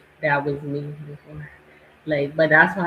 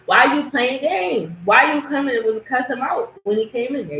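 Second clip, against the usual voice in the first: exasperated; 8 semitones higher, 4 dB louder than usual. Real, not acted.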